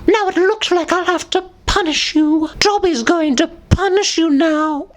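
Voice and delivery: high squeaky Dobby threat. Acted. Dobby threatening punishment, squeaky high. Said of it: "saying these as Dobby".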